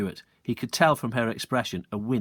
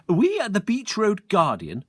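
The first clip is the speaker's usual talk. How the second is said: put-on higher, self-important girl; prim young reporter; pompous triplet; 9 semitones higher, 4 dB louder than usual. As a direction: announce the paper proudly. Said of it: "began a triplet".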